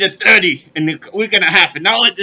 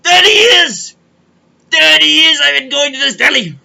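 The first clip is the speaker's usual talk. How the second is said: loud, higher outraged repeat; indignant rant; 5 semitones higher, 5 dB louder than usual. Real, not acted. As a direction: hammer home the outrage. Ranting about loyal thirty years.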